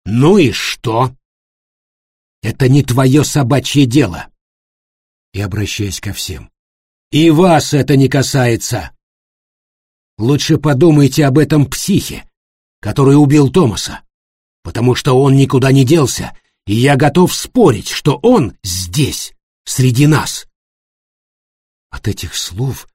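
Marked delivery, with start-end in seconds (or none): 0.0-4.3 rude retort. A narrator's reading. 7.1-20.5 ominous warning. A narrator's reading.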